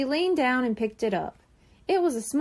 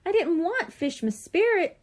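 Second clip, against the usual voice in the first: whiny; 6 semitones higher than usual. A narrator's reading. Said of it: "she whined".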